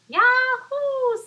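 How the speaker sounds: high, loud joyful whoop; excited yahoo. Acted. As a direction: cheer it like a bouncy bunny. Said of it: "said the bunny".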